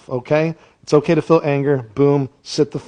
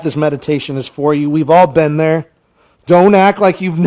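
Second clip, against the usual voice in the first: ordinary talk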